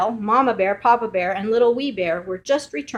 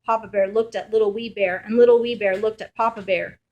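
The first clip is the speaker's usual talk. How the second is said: usual speaking voice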